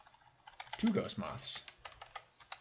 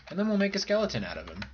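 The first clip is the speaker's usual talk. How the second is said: excited; 10 semitones higher, much faster than usual. Real, not acted. Plans to make a skeleton.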